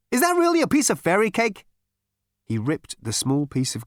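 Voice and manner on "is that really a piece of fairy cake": high, loud startled question; surprised Zaphod. A narrator's reading. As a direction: spot it with delight. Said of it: "he said".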